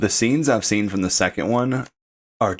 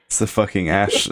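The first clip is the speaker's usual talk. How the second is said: ordinary talk, nothing marked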